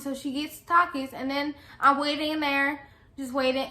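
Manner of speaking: ordinary talk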